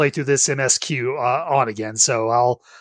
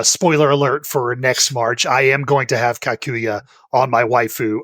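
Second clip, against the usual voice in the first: ordinary talk, nothing marked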